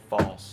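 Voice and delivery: falls, loud and dropped low; stress on falls. Acted. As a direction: punch the word falls. Emphasising the leper's fall.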